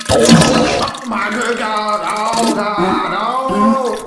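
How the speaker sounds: high, loud horrified yelling; disgusted shouting. Acted. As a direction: recoil in horror, shouting. Opens door to find poop.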